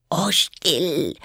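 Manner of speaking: high, alarmed shushing; frightened hush. A narrator's reading. Rabbit silencing Alice's laughter, frightened hush. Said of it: "flüsterte das Kaninchen sehr erschreckt".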